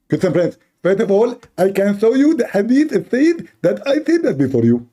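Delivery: squeaky mock lecturer; mocking apologist. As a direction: play the know-it-all defender, voice pushed up.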